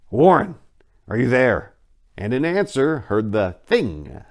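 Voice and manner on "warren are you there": loud, higher anxious call. A narrator's reading. Narrator calling into the receiver for his friend, anxious. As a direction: call down into the dark, urgently. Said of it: "Again, I called down".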